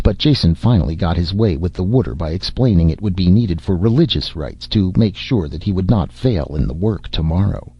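plain reading voice

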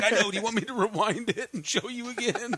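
high, laughing eagerness; bubbling over, begging to rewind; giddy offer. Retold or imitated. Urging wife to rewatch.

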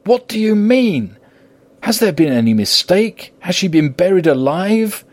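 high-pitched, desperate questioning; distraught, voice pitched far up; anguished outcry. A narrator's reading. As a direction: plead for an answer in anguish. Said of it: "He groaned in anguish".

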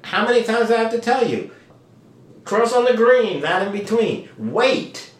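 high, loud exasperated parent; insistent parental warning, high-pitched; parent scolding child. Acted. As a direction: drill a safety rule into a child. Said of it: "parent is saying to the child".

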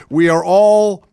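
hammed-up official mocked on air; mock pompous official. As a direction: read his words back as a mocking pompous quote. Mocking a quoted official.

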